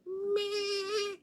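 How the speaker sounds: very high, held squeal of a first tone; extremely high-pitched. Retold or imitated. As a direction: imitate the teacher's sky-high first tone. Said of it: "with the highest pitched voice".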